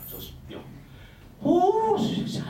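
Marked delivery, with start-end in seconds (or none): 1.4-2.0 high startled little oh; a quick high gasp of surprise; surprised oh. Retold or imitated. Shop lady caught off guard, surprised oh.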